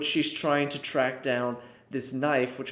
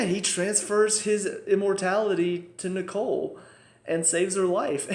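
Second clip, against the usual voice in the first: usual speaking voice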